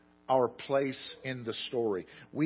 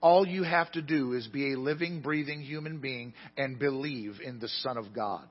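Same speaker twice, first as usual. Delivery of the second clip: higher, louder preaching build; fiery preaching; 4 semitones higher, 6 dB louder than usual. Acted. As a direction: proclaim it to the whole congregation. Preaching the verse at full force.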